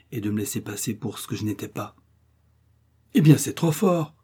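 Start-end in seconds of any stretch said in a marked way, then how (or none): 3.1-4.1 a louder, higher indignant cry; indignant outburst. A narrator's reading. Old woman furious at the scornful family, indignant cry. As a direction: stamp your foot and burst out. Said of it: "qu'elle s'écria en frappant du pied".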